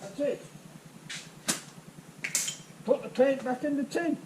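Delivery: muffled, as if through a mask. Real, not acted. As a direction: talk through a face mask. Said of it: "if you can hear my muffled voice".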